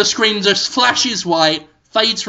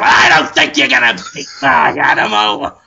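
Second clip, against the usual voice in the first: loud, higher, defiant shout; abrasive challenge; 6 semitones higher, 4 dB louder than usual. Acted. Farmer answering the rival farmer, abrasive shout. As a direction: throw the challenge back at the rival. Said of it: "They're so abrasive".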